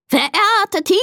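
very high, stretched announcer's crow; crowing rooster. A narrator's reading. Rooster Konrad opens the beauty contest, crowing high. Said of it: "krähte er".

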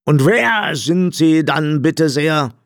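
mildly irked, high, drawn-out question; a touch of indignant annoyance; annoyed. A narrator's reading. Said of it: "fragte der blinde Räuber verärgert".

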